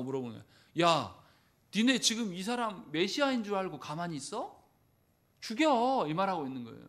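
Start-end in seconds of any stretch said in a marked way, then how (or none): 0.8-6.1 high, loud jeering push to kill; a jeering yell, higher and louder; goading shout. Acted. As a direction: egg them on loudly to kill him. Acting out the crowd's urging.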